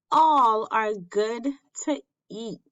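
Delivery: ordinary delivery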